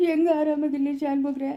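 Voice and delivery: weepy, anguished complaint; tearful protest. Acted. Betrayed man asks elder how he can rest, tearful. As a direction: protest through tears.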